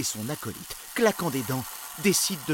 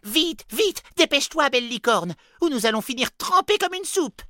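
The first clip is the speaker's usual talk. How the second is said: very high, loud urging; urgent shouting; 13 semitones higher, 9 dB louder than usual. A narrator's reading. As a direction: spur the unicorn on. Urging the unicorn on.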